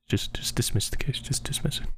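hushed conspiratorial whisper; low secret whisper. Pretend. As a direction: lean in and breathe it to the judge. Secretly urging the judge.

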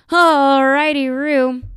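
loud, high, drawn-out singsong; goofy catchphrase. Acted. Distracted working mom repeats Ross's catchphrase, loud goofy singsong. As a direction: belt out a dorky, sung-out reply.

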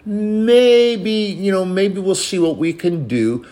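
a reluctant, noncommittal voice; mock God hesitating. Preacher mocking a hesitant God. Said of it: "He doesn't say".

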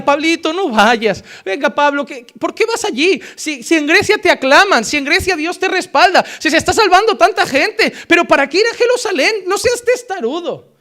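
súplica de los amigos, mucho más aguda; amigos suplicando. Retold or imitated. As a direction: ruega a Pablo que no vaya. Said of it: "lloraba y le decía". (los amigos suplican llorando a Pablo, voz aguda)

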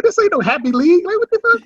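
high indignant outburst; high mock rant at the players; mock angry uncle. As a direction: imitate the uncle losing it. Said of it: "he said".

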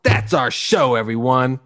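normal talking voice

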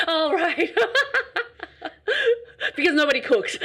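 talking through big laughs; laughing hard. Real, not acted. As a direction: laugh while teasing. Laughing at answer.

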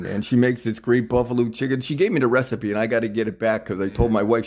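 usual speaking voice

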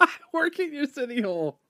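high laughing echo. Retold or imitated. Co-host echoes the tagline, high and laughing. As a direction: squeak it back through giggles.

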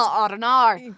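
broad Aussie vowels on a shrug; throwaway line in Australian; Australian accent. Shown as a demonstration. Actor joking with the host, broad Australian accent. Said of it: "Australian accent is my favourite accent".

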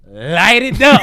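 high, loud hammed-up order; shouting to light it up, hammed up; mock outrage. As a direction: play an outraged president giving the order. Mock president ordering strike.

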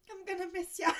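high, loud mock farewell; hammed-up loud goodbye with a laugh; mock farewell downward. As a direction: blurt it at the grave, laughing. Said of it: "she looks down at the ground".

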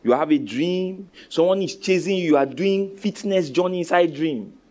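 ordinary delivery

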